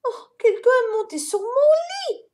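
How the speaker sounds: mama bear chiming in, high. A narrator's reading. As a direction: add it in a shocked mother's voice. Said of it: "ajouta Maman Ours".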